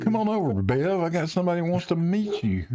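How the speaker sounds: high sing-song voice for the dog; calling to the dog. Real, not acted. Host calls his barking dog over to meet Bev, high and coaxing.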